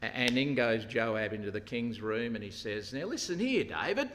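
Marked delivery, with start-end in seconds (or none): 2.9-4.1 higher, firm call to attention; retold blunt address to a king; forthright. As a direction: take him to task, pitched up. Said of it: "he's pretty forthright".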